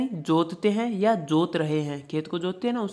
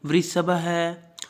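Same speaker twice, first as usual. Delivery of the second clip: वृषभ है drawn out slowly; slow, clear reading; slow recitation; much slower than usual. Shown as a demonstration. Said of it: "साथ में उच्चारण".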